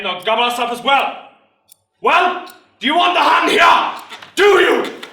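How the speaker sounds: rabble-rousing recruiting speech; sergeant major, rousing the crowd. Acted. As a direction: build to a bellowed challenge.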